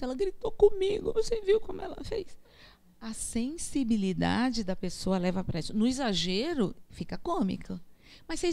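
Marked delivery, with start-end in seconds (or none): 0.0-2.4 much higher, put-on hurt whine; oversensitive complainer. Teacher mocking a sensitive earth-type person, hurt high whine. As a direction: play the wounded friend telling on someone. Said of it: "Aí ela chegou lá e disse".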